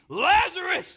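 full-voiced high bellow; a loud ringing call. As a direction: bellow the name into the grave. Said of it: "Jesus bellows, calling his name".

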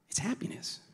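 a hushed, delighted whisper. Real, not acted. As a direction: whisper it's happiness tenderly. Repeated point in a whisper.

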